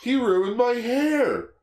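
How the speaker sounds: high, slow whiny squeal; high squeaky quote. Retold or imitated. Quoting a movie line.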